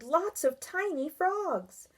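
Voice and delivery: put-on a mild child's shout, slow. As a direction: call out the discovery. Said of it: "Barry shouted".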